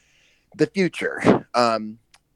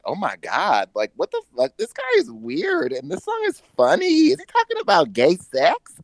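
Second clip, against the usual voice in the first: retold amazement; 12 semitones higher, 3 dB louder than usual. Retells first reaction to a song.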